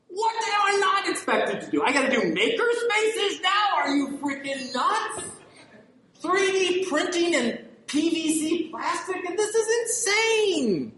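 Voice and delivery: mock the overloaded librarian's disbelief; exasperated librarian. Speaker imitating librarians complaining about new duties, high exasperated whine. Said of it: "librarians that sit there and go".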